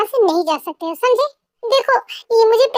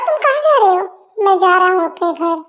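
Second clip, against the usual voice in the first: ordinary delivery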